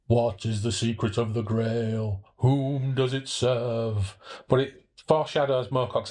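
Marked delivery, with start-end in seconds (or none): 0.1-4.2 mystic intonation. Acted. Quotes Grail legend in mysterious voice.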